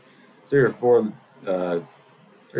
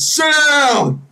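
loud high shout; heckling shout from the crowd; 6 dB louder than usual. Retold or imitated. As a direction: yell at her to sit down. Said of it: "me, I said".

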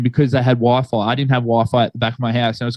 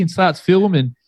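ordinary talk, nothing marked

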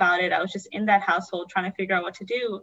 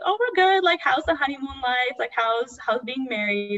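imitating a cheerful sister, high and bubbly; high-pitched, upbeat chatty greeting; happy, excited; 6 semitones higher than usual. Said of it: "she was just so happy about it".

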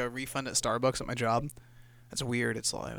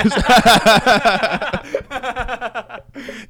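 loud laughing fit; 10 semitones higher, 10 dB louder than usual. Real, not acted. Big laugh at gross joke.

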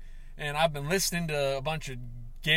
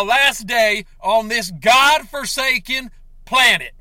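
rising revenge rant; 4 semitones higher, 8 dB louder than usual. Acted. Character rants to viewers about enemies, building to a furious shout. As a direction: vow vengeance on every enemy.

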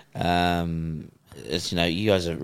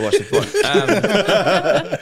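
laughing; 12 semitones higher, 6 dB louder, much slower than usual. Real, not acted. Caught on tape, laughing.

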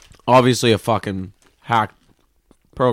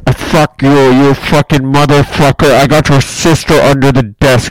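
loud furious scammer shouting abuse; enraged scammer; 2 semitones higher, 8 dB louder than usual. Retold or imitated. Caught scammer screaming abuse at the prankster, furious shout. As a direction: yell the insults back, seething.

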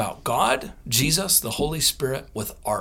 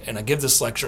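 normal talking voice

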